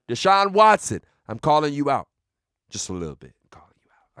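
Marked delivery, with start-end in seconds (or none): 2.7-4.2 barely audible whisper; sheepish whisper. Pretend. Host softening his call-out of a friend, whispering. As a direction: take it back under your breath.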